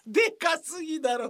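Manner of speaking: high mock outrage; incredulous outburst. Incredulous outburst at the oversized prop.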